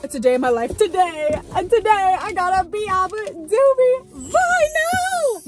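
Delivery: thrilled announcement; excited. Real, not acted. Unboxing a vinyl.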